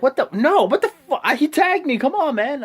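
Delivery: high, loud hammed-up protest; mock outrage. Fan acting out Jordan protesting to the ref, hammed-up outrage. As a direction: argue like you've been robbed. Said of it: "He's like".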